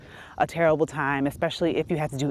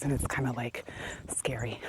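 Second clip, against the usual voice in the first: whispered scary; 5 semitones lower, 10 dB quieter than usual. Real, not acted. Vlogger telling viewers the deer are scary, whispering. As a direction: whisper it, much softer. Spooky remark about deer.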